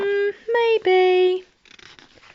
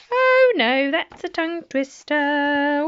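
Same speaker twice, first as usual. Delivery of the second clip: much higher, louder hammed-up "Oh no"; mock dismay; 5 semitones higher, 4 dB louder than usual. Shown as a demonstration. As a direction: overplay the panic for the kids. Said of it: "repeats it exaggerated".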